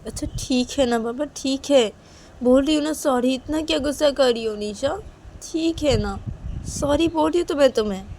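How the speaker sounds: high-pitched, placating sorry; exasperated apology. Acted. Friend promises never to do it again, exasperated pleading. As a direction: plead to be let off.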